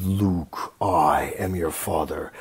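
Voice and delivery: low, slow Vader intonation, slightly hammed; Vader misquote. Misremembered Vader line to Luke, low and slow. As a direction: drop low and drag out father like Vader. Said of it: "I always said it was".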